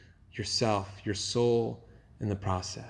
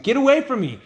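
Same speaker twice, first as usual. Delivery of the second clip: brushing off; 11 semitones higher, 5 dB louder than usual. Retold or imitated. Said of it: "he just kind of brushes you off".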